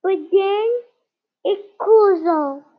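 jaguar pet voice. Acted. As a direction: play a cute little jaguar. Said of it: "this is what she sounds like".

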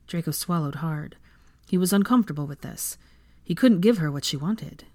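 ordinary delivery, nothing marked

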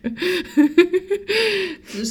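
long high giggle; helpless, high-pitched laughter; laughing fit. Real, not acted. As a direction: keep giggling, unable to stop.

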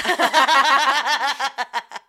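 really cracks up; loud burst of laughter. Real, not acted. Cracking up at her own joke to her cohost, big laugh.

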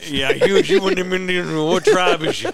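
backwoods Southern questioning, run together; mock swamp-local accent. Swamp local asking the visitor's tribe, backwoods accent. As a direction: imitate a nosy swamp dweller. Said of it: "the trip to the swamp".

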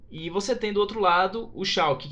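usual speaking voice

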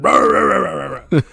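rolled growling dog noise; mock snarling, rolled r's; imitated dog attack. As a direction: mimic a dog tearing in.

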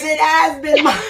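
high, whiny, laughing complaint. Acted. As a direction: whine it out with a laugh. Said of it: "You heard my whiny voice".

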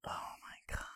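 stunned, voiceless whisper; hushed, real astonishment under the breath; awed whisper. Real, not acted. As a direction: whisper it as if floored by the idea. Awed reaction to the casting idea.

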